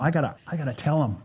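fast, tumbling repeat of the same words; rushed inner voice. Retold or imitated. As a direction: blurt it out in a hurry, half to yourself. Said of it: "you just go".

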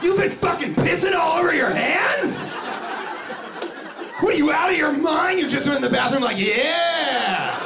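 a stranger shouting at a man with wet hands, mock furious; mock outrage. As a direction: blow up at him in disbelief.

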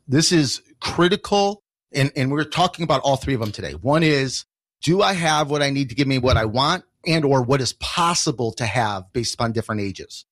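ordinary delivery

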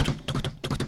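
whispered clip-clop tongue clicks; horse hoof clops. Retold or imitated. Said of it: "¿Y los caballos qué hacían?".

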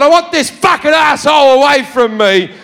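shouted, high and harsh; fed-up passenger shouting; angry shout. Retold or imitated. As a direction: yell it at the guy next to you. Shouting at seatmate on plane.

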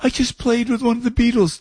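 put-on high, thrilled girlish quote; excited young star. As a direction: gush it like you just met a Beatle. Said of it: "She goes".

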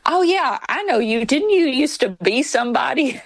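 mock dawning recognition; retold eager recognition. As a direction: act out a stranger suddenly placing a face. Stranger recognizing a former politician.

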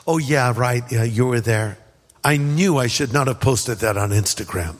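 mildly sheepish, low-pitched mock confession; low, rueful aside as if found out; sheepish admission. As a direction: play caught out, voice dropped low. Acts Peter caught out, joking.

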